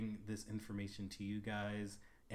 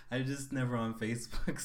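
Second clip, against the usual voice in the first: ordinary delivery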